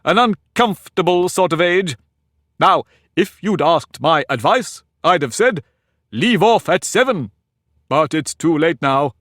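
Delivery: put-on pompous egg lecturing a girl; haughty egg. Humpty giving advice.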